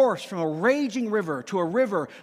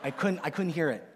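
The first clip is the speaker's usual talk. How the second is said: ordinary talk, nothing marked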